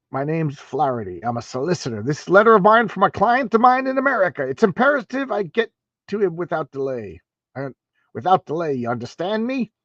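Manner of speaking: self-mocking stab at an Irish solicitor's accent, rising high on the letter line; a hammed-up Irish lawyer pressing his urgent errand. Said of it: "best I could do for an Irish accent".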